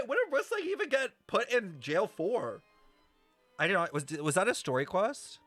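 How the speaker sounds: ordinary talk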